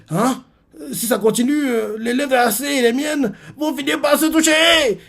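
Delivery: high, slow panicked cry; panicked classmate. Acted. Classmate hurled toward Ayase, high panicked squeal. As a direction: squeal in alarm as you fly at her.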